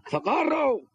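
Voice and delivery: hammed-up shriek for rescue; cry for help. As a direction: shout for help from down the hole. Worker calling for help.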